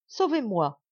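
pleading. Acted. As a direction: beg for rescue. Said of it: "la peur me fera mourir". (supplication apeurée)

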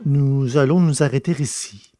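usual speaking voice

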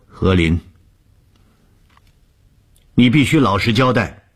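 high, loud stern order. A narrator's reading. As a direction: call it out as a commanding demand. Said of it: "高声地说".

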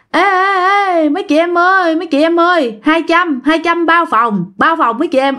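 high, sing-song call to the ladies; sleazy hustler call. Retold or imitated. Friend calling out to girls on the slope, sleazy hustler call. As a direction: hawk rooms like a cheeky pimp. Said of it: "theo kiểu đĩ đực á".

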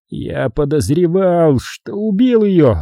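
slow, high, drawn-out confession; lingering, pensive admission; thoughtful. A narrator's reading. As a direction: muse slowly, as if hearing nobody else. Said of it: "задумчиво проговорил он".

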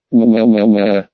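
low, hammed-up baby wailing; militant baby wail. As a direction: bawl like a warlike infant. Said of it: "воинственно кричу я".

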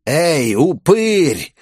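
very slow, high villain taunt; creepy clanking voice. A narrator's reading. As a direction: call out drawn-out, as a ghoulish bandit. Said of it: "вдруг пролязгал жуткий голос". (жуткий протяжный голос злодея)

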